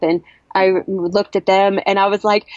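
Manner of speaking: normal talking voice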